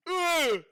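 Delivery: a sudden loud high grunt of fright; startled grunt. Retold or imitated. Mocking a jump-scare viewer, sudden loud high startled grunt. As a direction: jolt out a sharp scared grunt. You don't wanna just be like.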